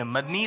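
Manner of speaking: مدنی said "mad-nee", no vowel after the d; wrong pronunciation. Shown as a demonstration. Host repeats the faulty pronunciation for contestants, mad-ni. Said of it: "تلفظ صحیح نہیں ہے".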